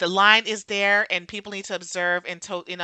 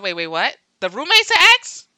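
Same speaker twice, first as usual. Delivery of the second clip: shocked; 3 semitones higher, 7 dB louder than usual. Retold or imitated. Retelling finding out the roommate is his ex, shocked. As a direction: blurt out the disbelief as it lands. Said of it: "I said".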